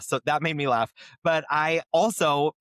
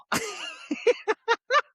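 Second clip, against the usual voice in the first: high laugh. Real, not acted. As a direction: let the laugh break out high. Laugh after punchline.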